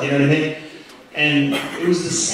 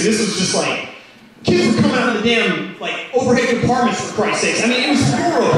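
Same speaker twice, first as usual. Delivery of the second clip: ordinary talk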